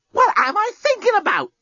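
high-pitched startled self-rebuke; appalled at herself. A narrator's reading. Lucy catches herself daydreaming about her captor, appalled. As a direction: pull up short, pitch leaping in disbelief.

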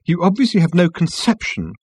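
high-pitched hauteur; haughty. A narrator's reading. Management consultant rebukes Ford, haughtily. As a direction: look down on him as you say it. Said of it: "in favour of good old-fashioned hauteur".

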